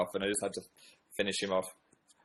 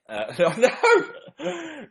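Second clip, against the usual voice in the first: high, drawn-out laughing refusal; laughing protest; 12 semitones higher, much slower than usual. Real, not acted. Vlogger abandoning his Australian attempt, embarrassed laughing protest. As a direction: break up laughing and back out.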